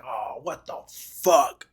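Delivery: lightly mimicked, long-drawn curse; drawn-out swearing. Retelling a boy's swearing in elementary school, drawn out. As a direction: imitate a slow, stretched-out exclamation.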